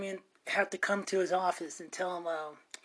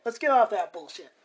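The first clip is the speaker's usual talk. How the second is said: normal talking voice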